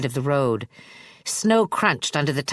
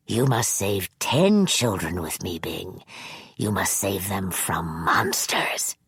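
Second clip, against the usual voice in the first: put-on low, whispery sinister old man; a creepy elder confiding a secret; 9 semitones lower than usual. Manx's held voice.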